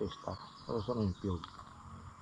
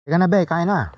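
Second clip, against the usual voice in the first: calling out; 9 semitones higher, 17 dB louder than usual. Real, not acted. Calling partner to eat.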